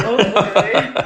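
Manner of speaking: mock-moan like a sulky stallion giving in; horse moaning. Owner voicing her stallion's moaning reply. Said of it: "he kind of moans about it".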